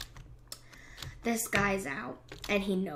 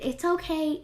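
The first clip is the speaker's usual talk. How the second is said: bright, higher "okay" comforting a loser; consoling; 6 semitones higher than usual. Real, not acted. Gamer consoling an eliminated player, lifted and kind.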